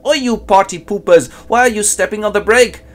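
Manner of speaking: high, loud cartoon taunt; cheeky prisoner. Prisoner taunting the jailers who stopped the pendulum, high loud cartoon jeer. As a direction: jeer at the torturers for spoiling the fun.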